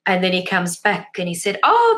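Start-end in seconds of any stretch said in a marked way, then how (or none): 1.6-1.9 high, loud delighted gasp. Retold or imitated. Neighbor hears son is home, delighted oh. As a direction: burst out with joy. Neighbor excited at good news.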